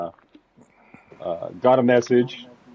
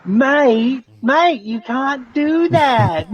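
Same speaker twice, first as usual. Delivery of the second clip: high, loud mock English accent; badly faked English accent, pitched way up and drawn out; horrible English accent; 13 semitones higher, 5 dB louder, much slower than usual. Host mocking a rival racer, high bad English accent. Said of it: "Sorry for my horrible English, uh, accent".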